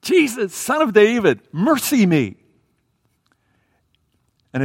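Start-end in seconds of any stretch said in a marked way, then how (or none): 0.0-2.4 high, insistent cry for mercy; yelling. Acted. Blind man yelling to Jesus for mercy, loud plea. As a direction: shout it out to be heard, pitched up. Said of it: "This man is yelling it".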